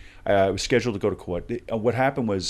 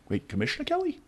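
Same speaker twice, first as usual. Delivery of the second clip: stunned disbelief; faster than usual. Retold or imitated. Stunned at commissioner's call.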